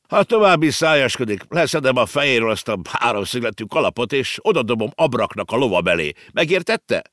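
gruff uncle warning the emperor; stern scolding. A narrator's reading. Uncle Ringelhut scolding Napoleon, mildly stern. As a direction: threaten him, sharp and loud.

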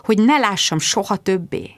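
usual speaking voice

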